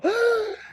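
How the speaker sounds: high sharp shocked gasp; nymphs gasping. Retold or imitated. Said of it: "all the nymphs go".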